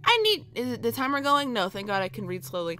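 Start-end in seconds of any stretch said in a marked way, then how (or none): 0.0-0.5 put-on high, fussy little old lady voice; high-pitched put-on old lady. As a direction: coo it high like a worried granny. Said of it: "a very happy old lady".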